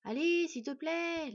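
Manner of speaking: high, drawn-out plea; wheedle, stretching the words; pleading. Acted. Boy begging the wolf to howl, pleading. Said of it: "la supplia-t-il".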